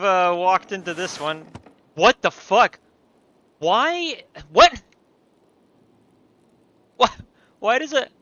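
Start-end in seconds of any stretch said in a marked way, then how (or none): 1.9-8.1 high, loud startled cries; loud real shock. Real, not acted. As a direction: jolt up in genuine surprise. Startled by weird pointing finger.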